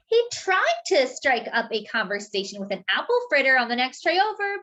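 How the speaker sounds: plain reading voice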